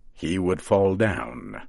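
plain reading voice

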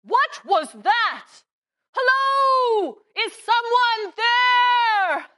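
shouted, urgent calling out; loud and serious. Acted. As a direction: call out loudly into the dark woods. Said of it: "speak more loudly and a little more, um, seriously".

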